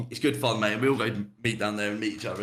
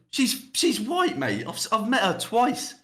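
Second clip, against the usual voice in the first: exasperated protest; 9 semitones higher than usual. Real, not acted. Exasperated protest, high pitch.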